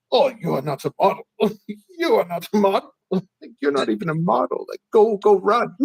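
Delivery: mock European accent, bluntly dismissive. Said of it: "they have no problem telling you".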